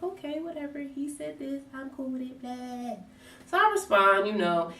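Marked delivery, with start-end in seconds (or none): 0.0-3.0 high, soft dismissive okay whatever; lovestruck shrug-off. Retold or imitated. To her boyfriend, softly shrugging off the worry. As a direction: brush it off, love-blind and airy. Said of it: "I'm blindly in love".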